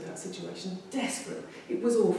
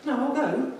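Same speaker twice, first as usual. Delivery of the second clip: resolved; 6 semitones higher, 5 dB louder than usual. Retold or imitated. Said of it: "And I thought".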